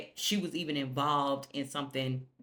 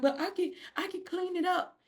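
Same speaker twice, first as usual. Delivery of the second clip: squeaky high plea; a high whiny promise to fix it; high pleading Sally; 13 semitones higher than usual. Retold or imitated. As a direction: beg in a tiny high voice.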